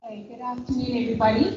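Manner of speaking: normal talking voice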